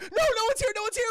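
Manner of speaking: high, loud panicked denial; acted panic. Cornered kid answering the intruder, acted panic. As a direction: blurt it out as the frightened kid.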